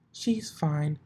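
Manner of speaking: high goofy pony; dopey high mare voice; Derpy voice. A narrator's reading. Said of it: "replied the Pegasus".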